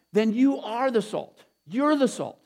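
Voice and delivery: usual speaking voice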